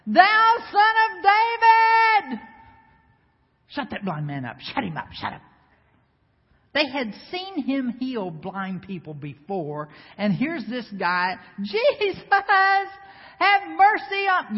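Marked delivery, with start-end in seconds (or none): 0.1-2.4 high, slow, loud cry for help; long pleading shout, high and loud; desperate cry. Acted. Blind man crying out to Jesus. 11.6-14.6 high, loud plea; loud begging shout, pitched high; pleading cry. Acted. Blind man crying out to Jesus.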